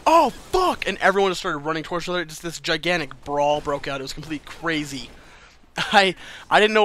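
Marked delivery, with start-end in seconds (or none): 0.1-0.9 sudden high, loud shout of alarm; retold yell. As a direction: yell it out in shock, pitched way up. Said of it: "I hear someone yell".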